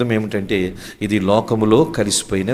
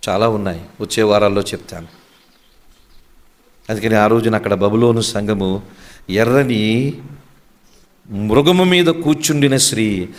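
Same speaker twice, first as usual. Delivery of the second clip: ordinary delivery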